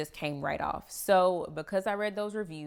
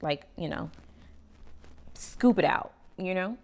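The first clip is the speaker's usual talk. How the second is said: ordinary talk, nothing marked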